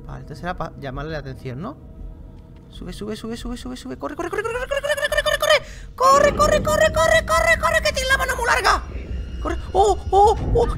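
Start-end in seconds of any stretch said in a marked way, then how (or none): 2.7-9.6 being chased. Real, not acted. Fleeing a chasing monster. 9.7-10.8 panicked oh. Real, not acted. Caught by the monster.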